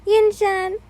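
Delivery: high, loud happy call; excited greeting. Acted. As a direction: squeal the name with joy. Mitsuri hugs excitedly from behind.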